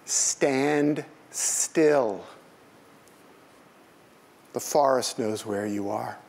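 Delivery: slow, measured poem recital; reading a poem aloud to the room. Acted. Reads a poem.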